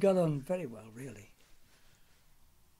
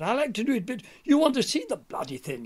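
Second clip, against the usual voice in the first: quoting Ronnie; 10 semitones higher, 5 dB louder than usual. Retold or imitated. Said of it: "He said".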